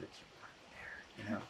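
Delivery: barely audible, voiceless whisper; whispered warning. Retold or imitated. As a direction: whisper it so only the guide hears. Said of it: "go back and whisper to everybody".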